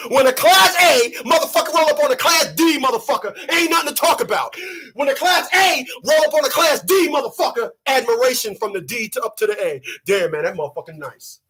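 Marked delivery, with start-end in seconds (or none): none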